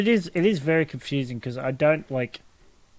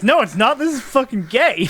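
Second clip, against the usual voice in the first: high, indignant Eric imitation; hammed-up bratty protest; protesting cartoon kid; 8 semitones higher than usual. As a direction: whine the objection, close to laughing. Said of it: "And he goes".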